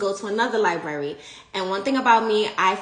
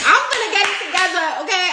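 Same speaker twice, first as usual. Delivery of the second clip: fast, high, loud resolve; rally yourself with urgency; fired up; 10 semitones higher, 6 dB louder, much faster than usual. Real, not acted. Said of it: "I'm telling y'all to wake up".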